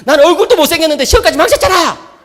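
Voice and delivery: put-on high, loud, laughing teen complaint; whiny son. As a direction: wail it like a sulky teenager. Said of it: "대뜸 하는 말이". (높고 크게 떼쓰는 아들 흉내)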